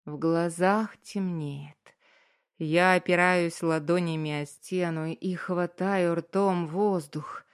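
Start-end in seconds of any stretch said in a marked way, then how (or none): none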